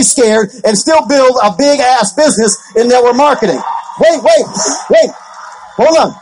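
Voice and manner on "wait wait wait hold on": sudden call to stop. Real, not acted. Interrupting himself.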